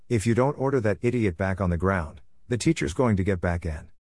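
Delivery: ordinary delivery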